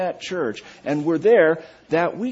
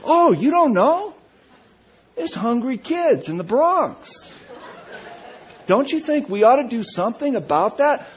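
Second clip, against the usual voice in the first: higher, louder amazed member; mock-amazed churchgoer, raised voice; surprised church member; 6 semitones higher, 3 dB louder than usual. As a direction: act shocked he hasn't heard.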